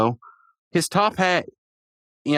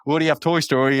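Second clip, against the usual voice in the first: ordinary talk, nothing marked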